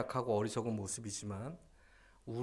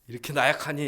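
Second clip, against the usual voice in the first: loud, higher mock reproach; mock scolding; 5 semitones higher, 10 dB louder, faster than usual. Preacher as mother wrongly berating her baby, mock scolding. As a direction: scold with a sudden raised voice. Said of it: "이러지 않아요".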